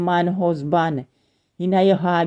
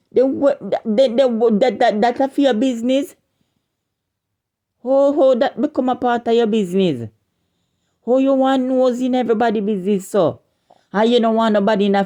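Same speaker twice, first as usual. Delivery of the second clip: higher, rising indignant scolding; pitched-up furious scolding; indignant outburst; 6 semitones higher than usual. Real, not acted. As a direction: demand it of her, outraged. Angry rant at a woman.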